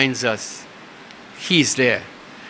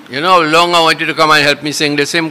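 usual speaking voice

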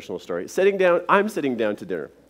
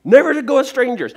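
put-on indignant child; 10 semitones higher, 9 dB louder than usual. Child refuses strangers.

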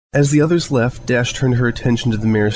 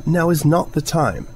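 slow, higher, cutting retort; icy prim rebuke; snapped; 7 semitones higher, slower than usual. Acted. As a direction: bite off each word. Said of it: "Rarity snapped".

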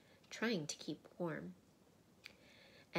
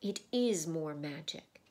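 loud, weighty lion's declaration; great voice; 6 dB louder than usual. A narrator's reading. Aslan answers the girls from behind, great loud voice. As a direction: declare it loudly with authority. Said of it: "said a great voice".

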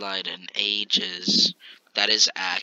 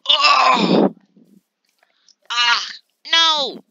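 mock wail; 14 semitones higher, 8 dB louder, slower than usual. Mock despair in a joke.

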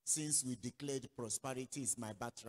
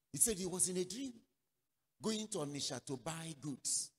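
ordinary delivery

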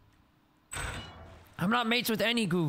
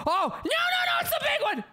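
panicked shriek. Real, not acted. Panicked at big monster.

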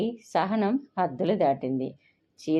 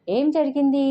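alarmed question; 8 semitones higher, 5 dB louder than usual. A narrator's reading. Mother wakes to the quarrel.

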